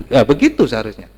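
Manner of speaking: ordinary delivery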